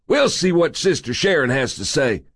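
high, indignant little man; bristling, high-voiced busybody; irate. A narrator's reading. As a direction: threaten to tell on him, pitched high. Irate man drags off intruder.

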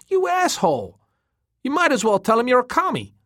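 loud, high-pitched incredulous rant; scornful tirade. A narrator's reading. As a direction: berate a friend for his stupid plan.

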